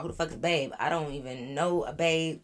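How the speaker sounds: ordinary talk, nothing marked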